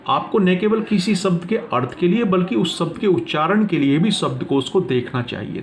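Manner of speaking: ordinary talk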